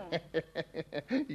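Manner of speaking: low, soft chuckle; eager little laugh; pleased chuckle. Acted. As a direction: giggle to himself with delight. Husband delighted by wife's offer.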